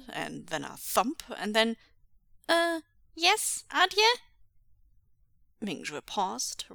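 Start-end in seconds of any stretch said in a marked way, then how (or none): 2.5-4.2 put-on high, loud child's call back; child caught out. A narrator's reading. Son calls back to father after a crash, caught out. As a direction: answer high, caught off guard.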